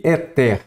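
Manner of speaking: ÉTER said as "e-TÉR", end stressed; stress shifted to last syllable. Shown as a demonstration. Teacher reads the accentless word as written, end-stressed. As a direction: read "éter" as if it had no accent, hitting TER. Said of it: "se está sem o acento".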